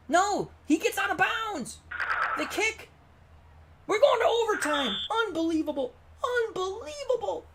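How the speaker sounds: high, stunned commentator call; excited play-by-play. Real, not acted. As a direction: keep calling it at fever pitch. Excited live call continues.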